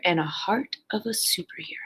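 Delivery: hushed, breathy whisper for the big moment; awed whisper to the class. A narrator's reading. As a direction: drop to a secret whisper. Dramatic climax of training montage.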